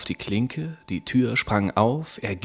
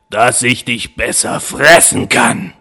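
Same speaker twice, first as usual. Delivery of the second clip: louder, gloating wolf; wolf dropping the disguise; menacing wolf; 7 dB louder than usual. Acted. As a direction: pounce on the last word. Wolf reveals himself.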